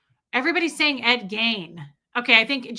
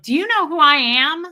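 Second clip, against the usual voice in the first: loud, higher-pitched mock outrage; imitating an entitled star's raised-voice demand; indignant celebrity; 5 semitones higher, 9 dB louder than usual. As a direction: pull rank on a cop, loudly. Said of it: "she said to the cop, she was like".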